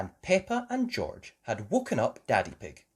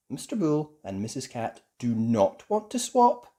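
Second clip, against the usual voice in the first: usual speaking voice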